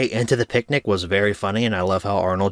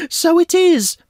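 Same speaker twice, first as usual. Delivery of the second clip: much higher and louder cartoon voice; Tiger voice; 5 dB louder, slower than usual. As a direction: pop it up high like a cartoon dog.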